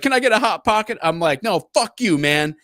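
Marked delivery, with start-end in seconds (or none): 0.0-1.0 loud, high imitated pestering; crackhead. Crackhead asking from the jail cell, loud high whine. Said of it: "he was like". 1.4-2.6 loud, high retold snap; annoyed refusal. Officer refusing a prisoner, loud annoyed snap.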